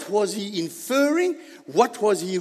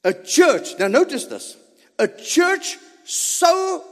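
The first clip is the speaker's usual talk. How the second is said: usual speaking voice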